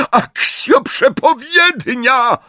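very high, drawn-out shriek; slow, shrieked summoning cry; screamed spell. A narrator's reading. Spell repeated more desperately.

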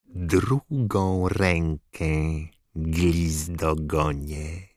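very slow icy menace; cold Dark Lord. Acted. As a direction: savour the cruelty, slowly. Voldemort's cruel denial.

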